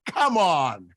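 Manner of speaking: loud, falling cry of disbelief; exasperated shout. Real, not acted. Co-host scoffing at a kept British accent, exasperated shout. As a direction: shout it like you aren't buying it.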